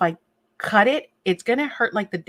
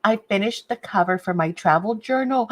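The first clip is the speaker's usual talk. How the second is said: usual speaking voice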